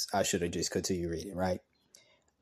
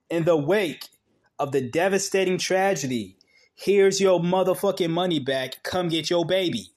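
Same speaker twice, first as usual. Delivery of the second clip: mocking the instructor; 7 semitones higher than usual. Mocking the swim instructor for viewers, scornful. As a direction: parody her callousness with disgust.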